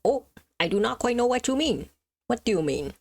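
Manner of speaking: normal talking voice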